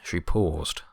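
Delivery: plain reading voice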